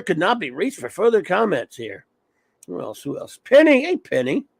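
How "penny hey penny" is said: bright jump up in pitch, loud; calling out. Real, not acted. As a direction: call her name out high and loud. Calling out a greeting in chat.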